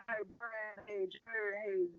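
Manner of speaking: high, hushed round of hellos; starstruck greetings. Retold or imitated. Retelling his hellos to everyone in the room, starstruck greetings.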